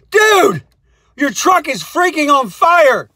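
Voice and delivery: high, loud panicked shout; panicked yell. Retold or imitated. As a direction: burst in yelling the bad news. Publisher staffer bursts in yelling.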